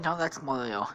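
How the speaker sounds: ordinary delivery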